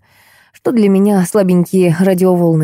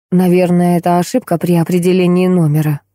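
ordinary delivery

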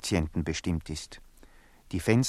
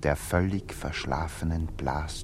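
hushed; 3 semitones lower, 4 dB quieter than usual. A narrator's reading. Narrator sets night scene hushed.

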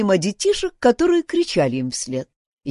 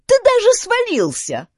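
put-on very high-pitched child's taunt; jeering child; 13 semitones higher, 4 dB louder than usual. A narrator's reading. Child mocks boy for falling, high jeer. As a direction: rub it in like a small kid. Mocking onlooking child, voiced by narrator.

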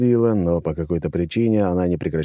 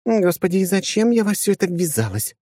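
higher, rueful woman's voice; dejected sigh; 12 semitones higher than usual. A narrator's reading. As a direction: sigh it out, defeated. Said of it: "сокрушённо вздохнула Носова".

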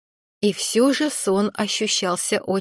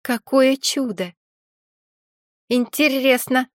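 higher, slow awe-struck cry; delighted exclamation; 4 semitones higher, slower than usual. A narrator's reading. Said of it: "воскликнула Арсина". (восхищённый возглас)

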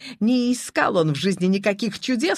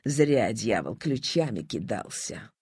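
low, soft rueful muttering; chagrined thought; 10 semitones lower, 6 dB quieter than usual. A narrator's reading. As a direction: grumble regretfully to yourself, low and quiet. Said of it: "огорчённо думал Шамов".

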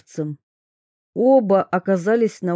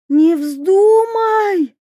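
high, slow, loud wail; desperate yell; 11 semitones higher, 6 dB louder, much slower than usual. A narrator's reading. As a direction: scream at her not to jump. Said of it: "завопила принцесса".

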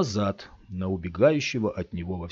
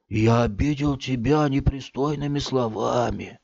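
higher, tearful self-pity; whiny lament; 6 semitones higher than usual. A narrator's reading. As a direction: wallow in loneliness. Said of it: "плаксивым голосом".

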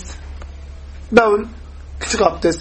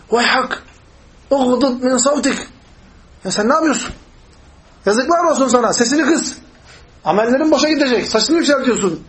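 higher-pitched indignant reproach; acted scolding; 5 semitones higher than usual. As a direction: scold a man for raising his voice. Companion scolds the loud Bedouin.

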